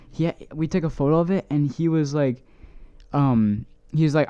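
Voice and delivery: normal talking voice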